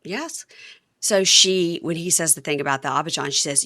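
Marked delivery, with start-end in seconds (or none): none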